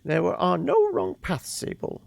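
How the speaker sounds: put-on high, airy old woman voice; a fond grandmother reminiscing; old lady voice. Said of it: "So we should do the old lady voice".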